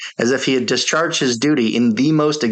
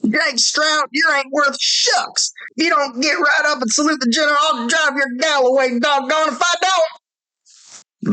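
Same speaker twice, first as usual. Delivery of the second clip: angry outburst. A narrator's reading. Officer scolding sentry before the general, high furious shout. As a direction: blow up at the lazy sentry, shrieking high. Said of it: "the officer broke out".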